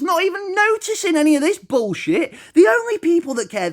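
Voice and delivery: high-pitched, incredulous protest; worked-up, squeaking indignation; exasperated rant. Real, not acted. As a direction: argue heatedly, voice climbing very high. Heated rant defending wild bees.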